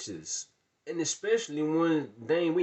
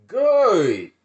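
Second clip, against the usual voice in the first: higher, loud final good; triumphant shout; 6 semitones higher, 13 dB louder than usual. Retold or imitated. Shouting good as the girls leave for good. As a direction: slam it shut.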